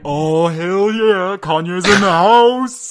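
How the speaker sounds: loud, slow hammed-up hype cry; hype man shout. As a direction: hype him up loudly. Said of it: "being like".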